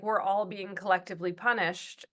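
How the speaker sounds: usual speaking voice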